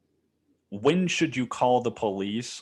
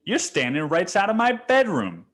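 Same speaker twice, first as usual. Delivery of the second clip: high-pitched re-enacted callout; mock-outraged complaint, pitched well up; indignant confrontation; 8 semitones higher, 3 dB louder than usual. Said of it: "And I said it like that".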